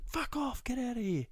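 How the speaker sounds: very high, exasperated squawk at normal volume; high-pitched, hammed-up outrage; mock frantic yell. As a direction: re-enact shooing a cat in a squeaky outburst. Retold yelling at hiding cat.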